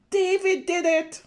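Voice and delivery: high loud accusing call; tattling shout. Acted. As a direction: point the finger, loudly. Brother accuses David.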